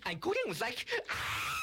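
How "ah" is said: high drawn-out mock scream; girlish scream. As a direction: scream like a girl. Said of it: "He screamed like a girl".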